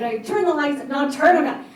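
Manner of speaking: mock a boss barking out instructions; imitated barked orders. He orders people about the lights, loud and bossy barking. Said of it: "he was barking some other orders".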